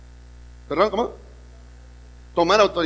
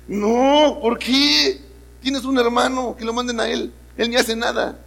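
put-on higher, complaining kid voice; whiny rebel; 5 semitones higher than usual. Rebellious inner voice complains to father, higher and whiny. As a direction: protest like a put-upon son.

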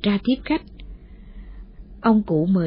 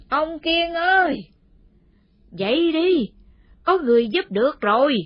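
calling out loud; 7 semitones higher than usual. A narrator's reading. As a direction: call him loudly from the gate. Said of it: "Bà cụ gọi tướng lên". (gọi to từ ngõ)

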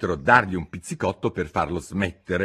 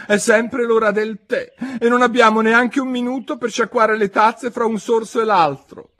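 Hatter; 8 dB louder than usual. Acted. Hatter voiced.

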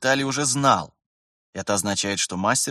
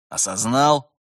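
raised, louder checking question; stern demand; 3 semitones higher, 5 dB louder, slower than usual. A narrator's reading. Terentyev demands the trainee acknowledge, sternly. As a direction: press him sharply for an answer.

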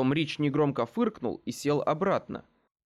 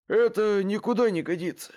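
higher, forceful voice of a fed-up man; exasperated; 6 semitones higher, 4 dB louder than usual. A narrator's reading. Rich cuts short the sisters' quarrel, exasperated. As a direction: put a firm stop to it.

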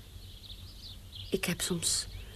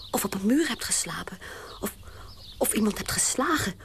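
ordinary delivery

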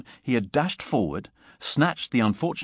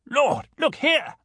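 high-pitched, drawn-out exclamation; excited cry; 4 dB louder, slower than usual. A narrator's reading. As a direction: cry out to get your companion's attention. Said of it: "cried Gibbon".

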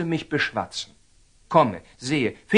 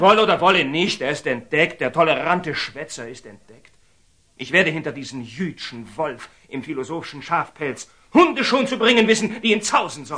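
furious outburst. Acted. Templar rages to the Sultan against Nathan, furious. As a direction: threaten him with venom.

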